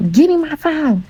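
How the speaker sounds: high-pitched yell at him; retold yell. Narrator yelling at him for her phone from the car. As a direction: shout it through the car window. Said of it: "yelling out the windows".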